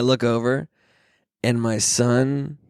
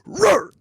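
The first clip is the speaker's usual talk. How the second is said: high sharp effort roar; dad grunting as he squeezes; mock Heimlich roar. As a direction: bark a quick Heimlich grunt. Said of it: "just go".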